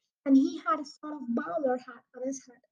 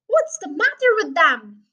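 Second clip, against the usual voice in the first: shouted; 3 semitones higher, 6 dB louder than usual. A narrator's reading. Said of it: "he shouted".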